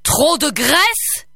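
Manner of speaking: incredulous ogre roar; belched out. A narrator's reading. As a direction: spit the words back in disbelief. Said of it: "éructa l'ogre".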